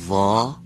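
ظا said thick and full; ظا dark and emphatic; heavy za. Shown as a demonstration. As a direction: say ظا heavy with a rounded mouth. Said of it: "با تلفظ چهار حرف آشنا بشیم".

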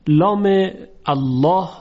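لام الله with a thick, velarized L; heavy lam in Allah. Shown as a demonstration. (لام الله با لام درشت و پر)

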